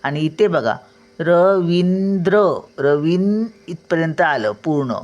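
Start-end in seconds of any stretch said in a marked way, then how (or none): 1.2-2.4 रवींद्र said slowly, each syllable clear, the final द्र crisp; teacher stretching रवींद्र so pupils hear the र; slow careful naming. Shown as a demonstration. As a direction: sound out रवींद्र slowly for the class. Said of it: "द्र बघा कसा उच्चार येतो".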